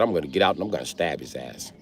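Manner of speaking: low, quiet deadpan menace; deadpan threat. Retold or imitated. Friend staring him straight in the eye, quiet deadpan threat. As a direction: drop low and soft for the threat. Quotes friend's deadly serious plan.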